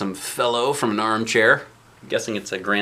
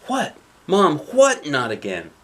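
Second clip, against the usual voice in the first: put-on little girl voice; 5 semitones higher, 4 dB louder, slower than usual. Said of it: "says a little girl".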